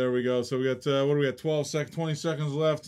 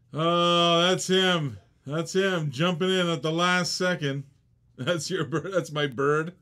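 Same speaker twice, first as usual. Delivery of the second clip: ordinary talk, nothing marked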